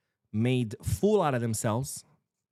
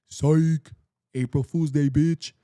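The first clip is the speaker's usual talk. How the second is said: louder, higher gloating mock prank voice; mocking taunt; 4 semitones higher, 8 dB louder, slower than usual. Club boss taunting the player over a broken promise, loud and gloating. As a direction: jeer it like you just pulled the prank of the year. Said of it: "Gil Marín says".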